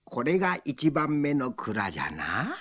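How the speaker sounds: lightly voiced rustic old man; a hint of a drawling village man.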